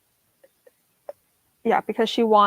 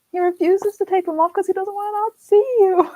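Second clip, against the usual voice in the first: excited; 8 semitones higher, 4 dB louder than usual. Real, not acted. Gushing over a sweet detail.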